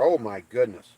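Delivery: ordinary delivery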